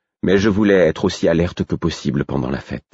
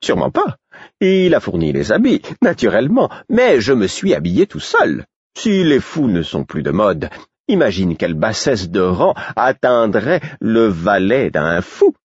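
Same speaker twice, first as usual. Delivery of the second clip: higher, lilting jester voice, slowing at the end; a jester showing off his new clothes, higher and playful; vain fool; 4 semitones higher than usual. Acted. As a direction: preen like a proud court jester. Fool boasts about his outfit.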